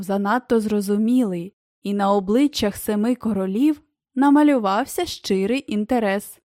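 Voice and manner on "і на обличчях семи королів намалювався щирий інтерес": ordinary delivery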